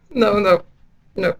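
laughing. Real, not acted.

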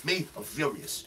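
usual speaking voice

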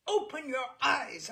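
put-on screaming at the drunk husband; screamed. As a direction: scream to wake him. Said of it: "I screamed".

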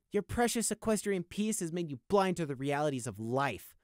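high, detached icy voice; cold. Acted. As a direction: answer with cold contempt. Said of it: "Prey responded coldly".

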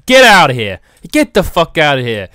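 loud outburst, kicking someone out; mock angry shout. Shopkeeper throws out a huffing customer, mock angry shout. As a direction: shout them out of the shop.

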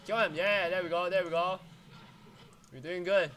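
loud cheering during fight; excited shouting. Real, not acted. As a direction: yell it, hyped. Team fight, cheering kills.

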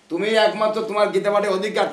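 high, ringing declaration; loud proclamation. Retold or imitated. Mahaprabhu's verdict to the Brahmin, loudly proclaimed.

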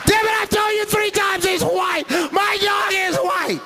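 high shouting woman's voice; shrieking high demand; irate woman. Retold or imitated. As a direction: scream it like an angry guest.

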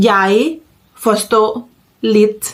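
slow model phrase with a pause after each word. Shown as a demonstration. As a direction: say each word separately for learners. (พูดช้าๆ ทีละคำ)

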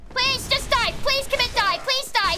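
frustrated high-pitched begging; exasperated pleading. Real, not acted. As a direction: plead at the enemy, high and insistent. Begs enemy to die.

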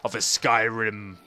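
low, slow storybook giant; playful giant voice. Pretend. Player looms over tiny villager, mock giant voice. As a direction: boom it out slowly, deep like a giant. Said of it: "I feel like I'm a giant".

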